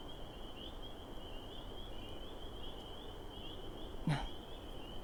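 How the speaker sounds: long, soft breathy sigh. Acted. Reluctant to tell the partner, a soft sigh. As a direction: let out a quiet sigh before answering. Pauses before answering partner.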